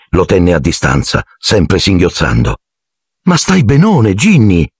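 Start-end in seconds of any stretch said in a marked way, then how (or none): 3.2-4.7 beaming. A narrator's reading. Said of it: "disse Ron raggiante".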